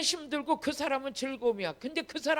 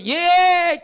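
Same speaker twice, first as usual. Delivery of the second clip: loud, drawn-out, higher "yes"; eager yes; 5 semitones higher, 11 dB louder than usual. Acted. Willing church member answering a chore request, eager shout. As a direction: answer gladly, long and loud.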